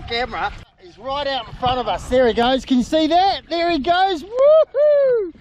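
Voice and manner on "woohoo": high, loud cheer of joy; excited whoop. Real, not acted. Cheering the surfacing baby whale, excited whoop. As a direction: whoop it out with delight.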